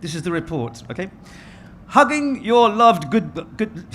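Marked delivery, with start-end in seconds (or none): 1.9-3.9 mock BBC newsreader accent, slow and a little louder; stumbling start of a hammed-up BBC news read; best BBC voice. Moderator reads a news report to the audience, mock BBC accent. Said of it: "in my best B B C voice".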